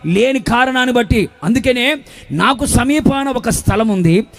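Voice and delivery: driving, insistent sermon delivery; full-force preaching. Real, not acted. Preacher warning a church without rebukers, full-force preaching. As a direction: hammer out each phrase like a preacher.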